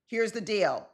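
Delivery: ordinary delivery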